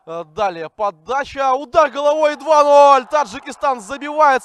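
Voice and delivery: loud, high goal shout; building to goal call. Real, not acted. Goal called live.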